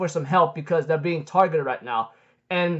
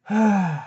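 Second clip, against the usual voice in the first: acted weary exhale; unimpressed sigh. Escanor faces Galen's full power, acted bored sigh. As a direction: breathe out as if underwhelmed.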